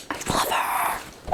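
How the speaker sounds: hushed whisper of delight; whispered fangirl aside. Real, not acted. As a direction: breathe it like a secret. Gushing aside while reading.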